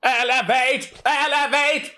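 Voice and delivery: high, shouted robotic Dalek. Acted. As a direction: chant it like a Dalek. Dalek impression.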